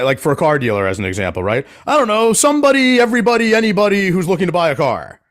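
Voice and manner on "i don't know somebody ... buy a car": high, careless shrug of a voice; clueless client voice. Retold or imitated. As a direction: toss it off like you never thought about it. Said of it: "but they'll be like".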